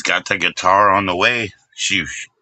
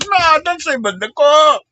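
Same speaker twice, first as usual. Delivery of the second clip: much higher and louder, cracking up mid-yell; laughing shout; 3 dB louder than usual. Real, not acted. Streamer cracking up at his own joke, laughing shout.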